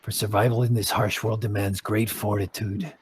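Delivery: low, soft grave druid explaining; solemn druid. Acted. Druid explaining the Enclave to new members, low and grave. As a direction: lay out the creed with quiet gravity.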